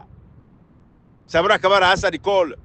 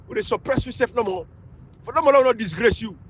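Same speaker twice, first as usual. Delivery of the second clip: usual speaking voice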